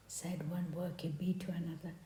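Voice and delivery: narrated line in a whisper. A narrator's reading. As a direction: breathe it out, no voice. Whispering bees gossip.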